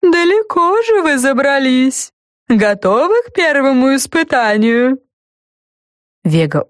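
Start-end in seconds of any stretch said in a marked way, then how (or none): 0.0-5.0 high, slow creaky greeting; talking lollipop. A narrator's reading. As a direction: creak like rusty metal. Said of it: "сказал леденец".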